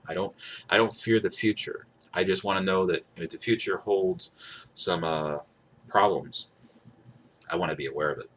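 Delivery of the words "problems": usual speaking voice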